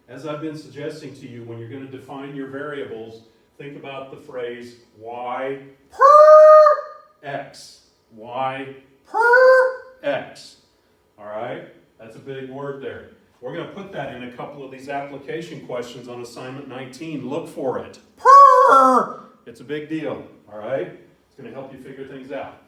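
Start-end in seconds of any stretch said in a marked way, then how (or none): 3.6-9.8 y per x stretched out slow and loud; drawn-out emphasis. Shown as a demonstration. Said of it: "I can't say that enough". 18.2-19.2 shouted emphasis. Shown as a demonstration. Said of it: "I can't say that enough".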